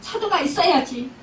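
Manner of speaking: retold telling-off with a touch of temper; mock angry scolding. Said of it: "막 역정을 내시는 거예요".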